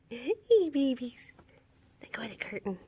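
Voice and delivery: unvoiced whisper, gently scolding; whisper to kittens. Real, not acted. She whispers to the kittens at night to let go of the curtain. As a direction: hush it to the kittens so nobody wakes.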